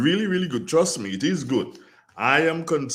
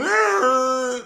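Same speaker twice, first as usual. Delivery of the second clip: high, loud imitated child's cry; mock child's wail; 12 semitones higher, 5 dB louder than usual. Host imitating a hungry child's wail.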